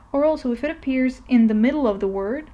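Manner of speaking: usual speaking voice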